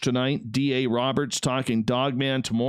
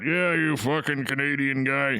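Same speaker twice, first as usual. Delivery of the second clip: lightly voiced grouch impression; a touch of put-on grumpiness; mock cranky guest. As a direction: tease the guest by imitating his grumbling. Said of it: "I know you're all about".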